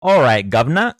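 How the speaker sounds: hammed-up Cockney British greeting; mock British accent. Streamer joking after chat asked for accents, mock British accent. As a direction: toss off a bad British accent. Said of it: "no more accents".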